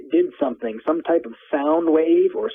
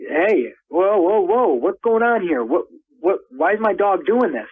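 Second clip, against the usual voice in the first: retold alarmed yell; 6 semitones higher, slower than usual. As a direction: call out in alarm at the strange men. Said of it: "as soon as I had yelled over".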